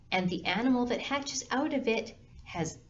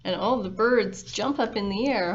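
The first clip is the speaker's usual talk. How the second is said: ordinary delivery, nothing marked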